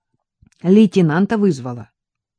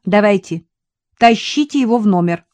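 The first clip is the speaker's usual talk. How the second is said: louder, higher firm command "тащите его в номер"; ordering; 4 semitones higher, 6 dB louder than usual. A narrator's reading. Katya ordering the men to drag him inside, commanding. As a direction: give a brisk order. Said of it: "приказала Катя".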